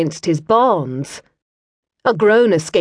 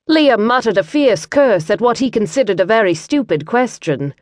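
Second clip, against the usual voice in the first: ordinary talk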